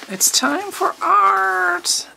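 high, drawn-out sing-song; playful sing-song. Acted. Reading the art box's slogan aloud, playful high sing-song. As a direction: stretch it out high, like a jingle.

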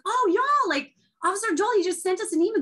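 high thrilled announcement to the class; excited teacher. Retold or imitated. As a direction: tell the kids the big news. Teacher thrilled about officer's email.

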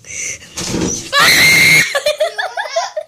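high shouted laugh; loud laughter. Real, not acted. Loud laugh after joke.

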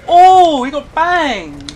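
high, loud cheer; gleeful shout. Real, not acted. Player cheering as an enemy gets killed, gleeful and loud. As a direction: crow over the kill.

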